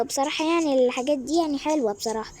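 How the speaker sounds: ordinary talk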